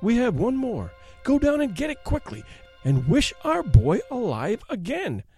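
high, urgent exultation; triumphant. A narrator's reading. Wife ordering husband to wish, triumphant and urgent. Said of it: "she cried triumphantly".

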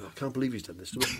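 grumbling. Retold or imitated. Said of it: "All having a bit of a grumble".

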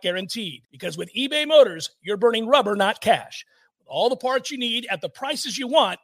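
upbeat commercial announcer; ad read. Acted. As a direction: sell it like a radio spot. Recorded car parts ad read.

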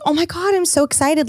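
high-pitched excited gush, retold; excited friend. Quoting Chesko about the Hawaii trip, excited. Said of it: "just excited question".

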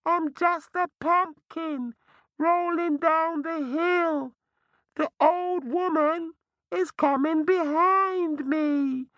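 very high, innocent little voice; round hollow pumpkin voice. Acted. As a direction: squeak it like a fibbing pumpkin. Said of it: "a voice said".

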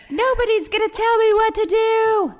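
high, loud rebellious yell; defiant teen shout. Retold or imitated. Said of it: "But it's like".

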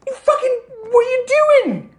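very high, loud outrage; high-pitched acted fury; exasperated farmer. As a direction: squeal it in disbelief. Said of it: "He's just like".

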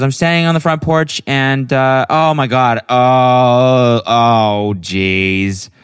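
mimicked friend, high then sinking into drawn-out dismay; loud, stretched-out mock "oh jeez"; Bridget realizing. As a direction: imitate a friend slowly realizing the mistake. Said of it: "She goes".

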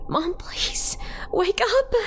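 very high, breaking into breath; desperate pleading. A narrator's reading. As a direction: beg mother urgently, pitch soaring. Child tries to wake unresponsive mother.

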